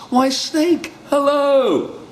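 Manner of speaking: high, bright mouse greeting. Acted. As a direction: chirp hello brightly.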